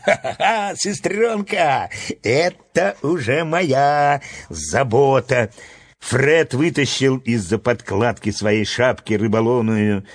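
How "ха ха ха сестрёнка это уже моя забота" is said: laughing boast. Acted. Fred reassuring his little sister about the catch, laughing. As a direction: laugh it off cockily, stretching the words.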